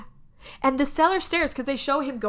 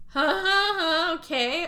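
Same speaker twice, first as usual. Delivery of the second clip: nervous higher huh huh; nervous laugh; 7 semitones higher, 3 dB louder, slower than usual. Retold or imitated. Husband nervously playing along.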